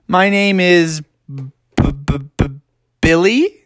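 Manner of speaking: "B-, B-, B-" stuttered before "Billy"; hesitant stammer. A narrator's reading. Bucky invents a name for his parents, stammering on "Billy". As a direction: stall on the B of "Billy", then lift it like a question.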